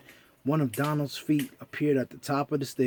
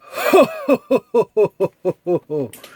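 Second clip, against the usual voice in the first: loud, high laugh; hearty laugh; 4 semitones higher, 8 dB louder than usual. Real, not acted. Reader signing off to the children, laughing loudly.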